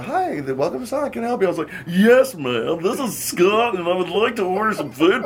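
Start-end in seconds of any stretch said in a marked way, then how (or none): 0.0-1.5 higher, lightly mimicked cheery order-taker greeting; a hint of a perky drive-in worker; imitating the drive-in girl. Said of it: "the girl that comes up". 1.9-5.2 higher, louder goofy made-up voice; put-on funny voice. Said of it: "I was talking like this".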